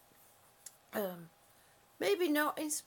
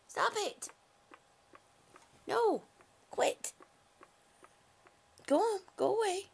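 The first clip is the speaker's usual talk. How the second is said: sharp, louder, higher scolding; scolding the cat; 6 semitones higher, 6 dB louder than usual. Real, not acted. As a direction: shoo the cat off firmly.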